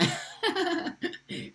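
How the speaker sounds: high-pitched burst of giggles; real amused laughing fit. Real, not acted. Amused by remark on pronunciation.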